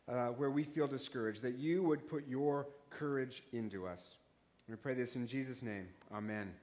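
hushed earnest opening prayer. Acted. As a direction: pray quietly to open the sermon. Opening prayer before sermon.

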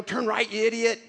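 a rude sat-nav, lightly voiced; mock yelling GPS. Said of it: "Doesn't yell at you".

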